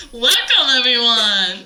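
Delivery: high, loud, bright host intro, laughing; podcast host greeting. Retold or imitated. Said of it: "And I'm like".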